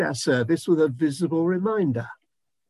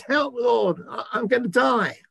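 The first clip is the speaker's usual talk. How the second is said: mock panic; 7 semitones higher than usual. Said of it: "Gideon freaks out".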